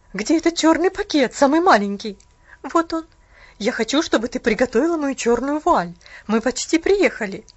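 loud, curt demand from the bitter aunt; clipped orders from a bitter old aunt; stern aunt. Acted.

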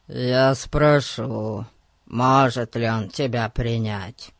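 very slow, put-on ominous drawl; hollow voice. A narrator's reading. Disguised policeman offering to ask, hollow drawl. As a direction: stretch the words in a dark disguised voice.

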